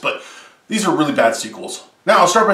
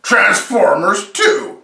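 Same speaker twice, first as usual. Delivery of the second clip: countdown announcer; 6 semitones higher, much slower than usual. Acted. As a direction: announce the entry like a game show host. Countdown title card.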